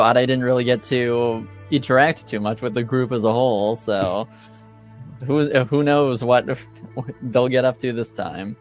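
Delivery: ordinary delivery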